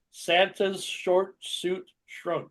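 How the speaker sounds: very slow, each word apart. Shown as a demonstration. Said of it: "How about this one".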